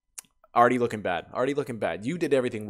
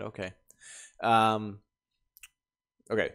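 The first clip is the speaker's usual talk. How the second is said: usual speaking voice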